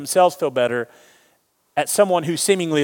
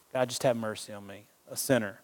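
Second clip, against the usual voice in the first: much softer, lower, fading to a breath; hushed plea; 6 semitones lower, 12 dB quieter than usual. Retold or imitated. Tax collector pleading with God, hushed and low. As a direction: beg for mercy almost under your breath. Repeated humble prayer.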